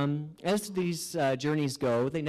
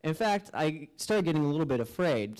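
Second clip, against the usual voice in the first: ordinary talk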